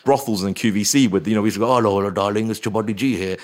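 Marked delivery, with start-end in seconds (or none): 1.7-3.4 prank-call character voice with put-on accent; Chabuddy G. Prank caller ringing a shopping channel, in character voice. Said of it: "came up with that kind of voice".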